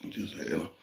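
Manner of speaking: conspiratorial whisper. Retold or imitated. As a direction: drop to a secretive whisper. Hinting at money, whispered.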